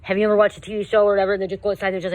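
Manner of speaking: normal talking voice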